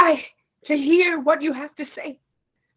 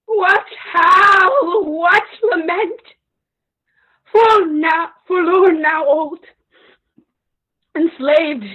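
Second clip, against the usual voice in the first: high, loud wailing grief; keening cry of a ruined queen; loud lament; 4 semitones higher, 7 dB louder, slower than usual. Acted. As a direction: cry out the loss through tears. Hecuba laments her enslavement.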